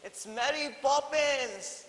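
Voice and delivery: high, loud, slow delighted shout; surprised yell. A narrator's reading. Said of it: "yelled with surprise".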